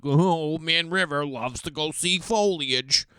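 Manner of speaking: slow, lightly voiced creaky old man; mock an old geezer, drawn out. Mocking himself as an old man who loves foliage, slow old voice. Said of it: "I'm like".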